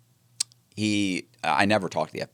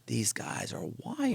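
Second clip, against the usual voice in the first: slow, hushed whisper to oneself; whispered realization; 4 semitones higher, 11 dB quieter, slower than usual. Retold or imitated. Realizing the couple are wearing a wire, whispered realization. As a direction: whisper it slowly as the truth dawns. Acted private thought about wire.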